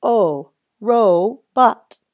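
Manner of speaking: "O, robot" slow and loud, as OH, ROH, bot; long O sound. Shown as a demonstration. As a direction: stretch each O and pause before "bot" in "robot". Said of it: "it needs a long sound".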